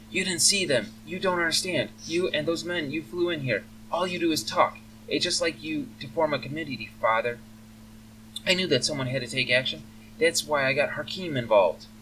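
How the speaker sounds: high-voiced, indignant young elf; upset elf daughter. Elf daughter scolds her father for only talking, upset.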